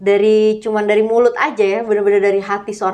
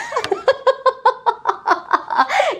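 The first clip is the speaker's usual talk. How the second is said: high laugh; 14 semitones higher than usual. Real, not acted. Listener laughs at story.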